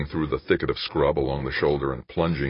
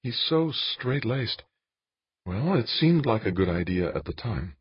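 higher voice for a woman character; Jane, subdued; 7 semitones higher, 3 dB quieter than usual. A narrator's reading. Jane explains her secret marriage to Dan, high subdued woman's voice. Narrator voices Jane's dialogue.

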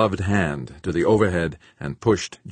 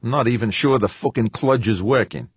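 louder, higher irritated outburst; snapping; 7 semitones higher, 6 dB louder than usual. A narrator's reading. George doubting the laser works, irritated snap. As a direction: vent frustration at the machine. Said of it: "George snapped".

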